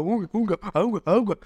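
high hooting ape grunts; ape cries. Retold or imitated. Five friends splashing water, howling like high apes. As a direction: hoot it like a splashing chimp. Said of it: "echándonos agua y gritando".

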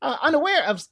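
high squeaky disbelief; incredulous dad. Retold or imitated. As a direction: act baffled it's so obvious. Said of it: "I was like".

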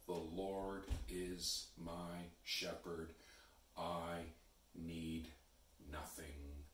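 slow and soft, a pause between words; hushed, slow meditative recitation; slow, prayerful. Shown as a demonstration. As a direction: linger on each word like a quiet prayer. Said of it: "to say those words slowly".

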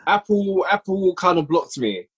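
normal talking voice

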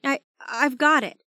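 stammering and frightened; shaking. Acted. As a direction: stammer it out in fear. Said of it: "I started to shake".

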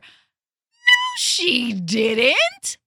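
indignant shout of no; furious denial. Real, not acted. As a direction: snap the no out in outrage. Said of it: "I'm furious".

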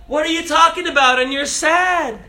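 mock Jesus chatting with the Emmaus disciples. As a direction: play Jesus as a chummy stranger. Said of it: "he's like".

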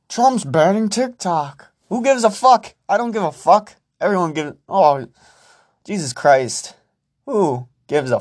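high-pitched, exasperated outburst; pissed-off rant. Real, not acted. Podcaster fed up with TikTok in the news, pissed-off high rant. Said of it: "I'm just pissed off".

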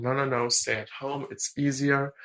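ordinary talk, nothing marked

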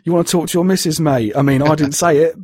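high, protesting Cockney; Cockney chef. Acted. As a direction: play an indignant Cockney chef. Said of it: "I don't know why it's Cockney".

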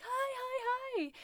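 put-on very high, sing-song baby talk to animals; puppy voice. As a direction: coo at a puppy in a squeaky voice. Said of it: "I was using my puppy voice".

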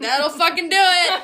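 high, loud delivery through laughter; laughing punchline. Retold or imitated. Finishing the TikTok bit, loud and high through a laugh. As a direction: crack up as you land it. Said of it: "they say something like".

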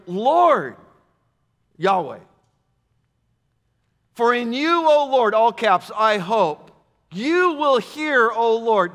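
loud, high scripture proclamation; emphatic preaching. Acted. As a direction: proclaim each divine name with force.

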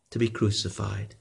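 usual speaking voice

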